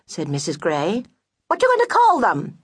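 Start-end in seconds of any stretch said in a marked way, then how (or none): 1.5-2.6 much higher, quick and prying; high nosy friend. Acted. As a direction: rattle off the question like a busybody. Friend voice again.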